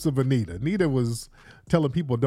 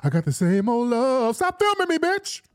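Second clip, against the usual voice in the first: much higher, sung line breaking into a cussing snap; 8 semitones higher than usual. Retold or imitated. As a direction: sing sweetly, then turn on the camera. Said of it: "cursing people out in mid-song".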